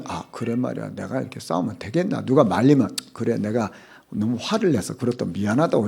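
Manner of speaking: a hint of a sorry, softened man; lightly played reasonable man, apologising; calm apology. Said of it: "좀 정상적인 사람 같으면".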